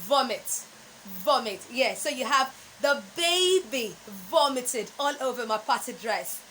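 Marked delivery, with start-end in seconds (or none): 0.1-1.6 vomit with a short o, VOM-it; short, crisp VOM-it; correct pronunciation. Shown as a demonstration. Coach drilling students on the right vomit. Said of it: "The correct pronunciation is vomit".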